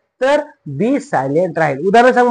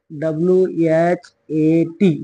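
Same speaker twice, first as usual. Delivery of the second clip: usual speaking voice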